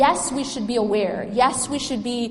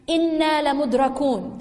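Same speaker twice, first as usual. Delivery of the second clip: Quran recitation; 3 semitones higher, much faster than usual. Acted.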